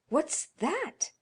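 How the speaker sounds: put-on high startled cry of an old woman; frightened cry. Old woman startled awake by a knock, crying out in fear. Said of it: "cried the old woman".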